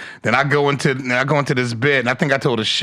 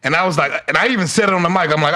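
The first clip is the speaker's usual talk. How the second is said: usual speaking voice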